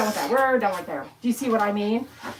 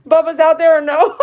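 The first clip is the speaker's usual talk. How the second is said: louder, laughing through the words; 5 semitones higher, 7 dB louder than usual. Real, not acted. Groomer joking to her dad watching online, laughing loudly. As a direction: tease dad while cracking up.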